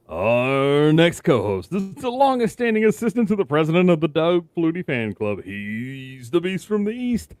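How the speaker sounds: big showman intro voice; announcer voice. Acted. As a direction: hype it like a ring announcer. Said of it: "the intro's getting better every single week".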